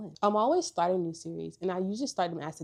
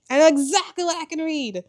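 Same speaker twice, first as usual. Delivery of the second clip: much higher and louder, thrilled, stress on know; excited repeat; 10 semitones higher, 13 dB louder than usual. Shown as a demonstration. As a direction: say it again bursting with excitement. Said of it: "repeated louder and higher".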